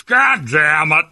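high, drawn-out, loud American caricature; a Brit's hammed-up fake American; mock American accent. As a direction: exaggerate a slow, stretched American accent. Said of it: "do the American accent back".

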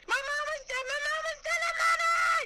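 very high, squealed mock impression; mock tattling wail. Co-host imitates Heather telling on her mama, mock high-pitched wail. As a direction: squeal it like a hammed-up tattletale child.

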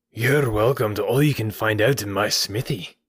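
rough innkeeper character with false good humour; grudging, menacing welcome in character; menacing landlord. A narrator's reading. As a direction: invite them as a veiled threat. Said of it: "grim mouth loosened into a false laugh, which was more menacing".

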